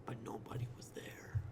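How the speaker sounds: whispered eerie reveal; spooky whisper. Pretend. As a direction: hush it like a ghost story.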